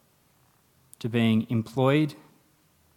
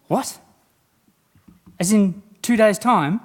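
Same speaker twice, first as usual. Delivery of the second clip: shocked; 10 semitones higher, 9 dB louder than usual. Retold or imitated. Mum realises it's Thursday.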